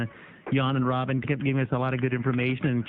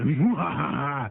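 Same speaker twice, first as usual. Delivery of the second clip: slow, drawn-out evil laugh; mad scientist laugh; much slower than usual. Shown as a demonstration. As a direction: stretch each syllable like a villain. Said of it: "From the diaphragm".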